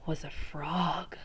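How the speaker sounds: soft, slow whispered reveal; dramatic whisper. Acted. Teacher revealing the frog to the class in a slow whisper. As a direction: drop to a hush for the surprise. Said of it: "unvoiced whisper reveal".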